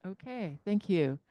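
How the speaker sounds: ordinary delivery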